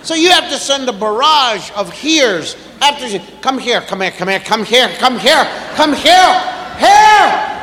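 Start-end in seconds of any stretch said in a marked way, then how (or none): 3.4-7.5 building to a shout. Retold or imitated. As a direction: rattle them off, then yell. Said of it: "Sound like a tobacco auctioneer".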